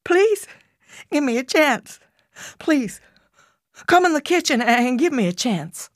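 high, desperate begging; pleading. A narrator's reading. Mother begs her children for another chance, high and pleading. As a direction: beg them to stay.

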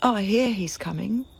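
normal talking voice

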